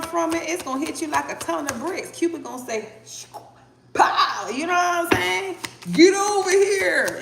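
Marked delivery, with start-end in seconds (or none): 3.9-4.5 sudden loud "Pow!"; pow shout. Pretend. Cupid hitting you, sound effect. 5.9-7.2 high, loud, slow "Get over here"; scorpion voice. Retold or imitated. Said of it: "in my scorpion voice".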